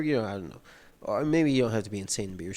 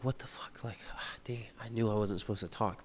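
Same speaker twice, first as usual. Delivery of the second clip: put-on hushed, breathy whisper of a freaked-out kid; whispered inner panic; 6 dB quieter than usual. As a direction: whisper it like you're scared to be heard. Hal's panicked inner thoughts retold.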